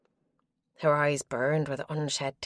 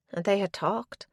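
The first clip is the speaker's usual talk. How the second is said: plain reading voice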